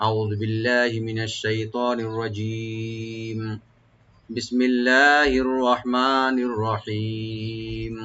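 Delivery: slow, drawn-out chanted recitation; Quran recitation. Shown as a demonstration. Teacher opening the lesson in slow Quranic chant. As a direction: recite each syllable long and measured.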